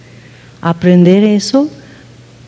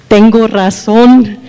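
"Tengo razón" said slower and louder, stressed on "razón"; stubborn husband, louder; 2 semitones higher, 5 dB louder, slower than usual. Retold or imitated. Wife mimicking her husband's stubborn insistence, louder and slower. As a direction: dig in on "Tengo razón", drawn out and firm. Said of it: "me hace".

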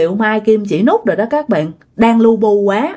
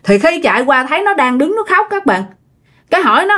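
usual speaking voice

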